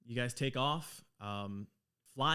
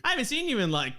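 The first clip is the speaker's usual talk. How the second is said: much higher Australian, laughing through it; laughing Australian dwarf; 9 semitones higher, 5 dB louder than usual. Acted. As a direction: joke in a cheeky Aussie lilt. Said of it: "her accent has always been the same".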